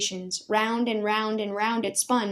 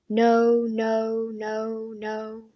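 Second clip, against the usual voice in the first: fading echo; much slower than usual. Acted. Commander's word echoing through the empty hall, fading. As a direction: let it echo and fade to nothing.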